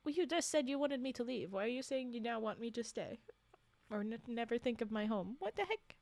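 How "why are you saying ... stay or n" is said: ordinary delivery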